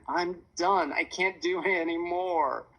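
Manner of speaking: high, drawn-out declaration of defeat; exhausted whine. A narrator's reading. Daughter dropping to the ground mid-workout, exhausted whine. Sophia collapses, declaring she quits.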